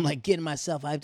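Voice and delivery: ordinary talk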